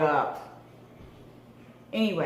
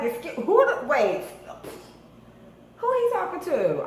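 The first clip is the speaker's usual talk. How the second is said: higher, indignant disbelief; mock offended; 7 semitones higher than usual. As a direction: play offended. Insulted by stranger.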